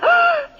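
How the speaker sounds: sharp high gasp of surprise; a high startled gasp from the hostess; surprised gasp. Acted. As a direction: gasp as if the news startles you. Hostess hears he's coming out.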